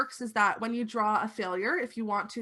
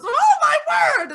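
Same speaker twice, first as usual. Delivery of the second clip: put-on shrill old lady shriek, much higher and louder; startled granny exclaiming; old lady cry; 13 dB louder than usual. GM's old lady hears a glass shatter and shrieks. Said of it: "the old lady goes".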